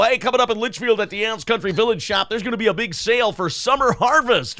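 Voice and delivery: fast, nasal local radio ad read; hammy small-town radio commercial. Shown as a demonstration. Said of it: "I didn't go up into my nose and go".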